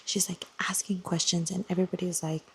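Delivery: breathy whispered gossip. Real, not acted. Retelling the plot to viewers in a whisper. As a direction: whisper it like a secret. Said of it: "unvoiced whisper".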